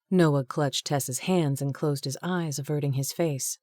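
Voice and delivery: ordinary delivery, nothing marked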